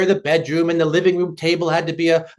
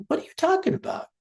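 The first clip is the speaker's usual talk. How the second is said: high, soft, quick puzzled question; quietly bewildered, pitched way up; baffled child; 10 semitones higher, 6 dB quieter, faster than usual. Retold or imitated. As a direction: imitate a kid with no idea what Dad means. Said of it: "He looks at me like".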